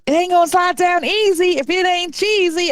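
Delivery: high catchphrase voice; sing-song catchphrase quote; quoting a catchphrase. Retold or imitated. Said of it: "I keep quoting".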